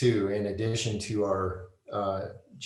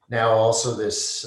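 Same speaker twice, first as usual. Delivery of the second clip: ordinary talk, nothing marked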